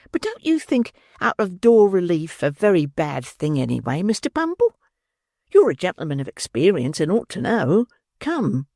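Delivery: lightly voiced coaxing matron; coy matron voice. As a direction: flatter him coyly.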